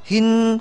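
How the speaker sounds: hin ending on a held 'n'; wakaf on nun. Shown as a demonstration. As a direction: stop on a hummed n in hin. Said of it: "baca seperti itu". (hin berhenti dengan dengung)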